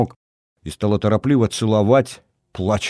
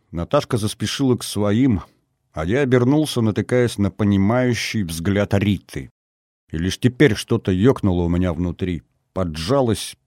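usual speaking voice

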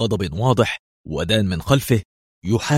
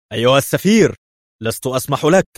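higher, loud opening objection; indignant protest; 4 semitones higher, 5 dB louder than usual. A narrator's reading. Bruno objects to the ambassador's insults, indignantly. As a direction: protest hotly at being insulted.

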